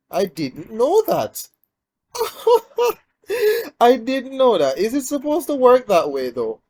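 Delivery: loud, high astonished outburst with a laugh; real surprise, pitched well up and loud; amazed. Real, not acted. Gamer discovers an unexpected skill use, amazed.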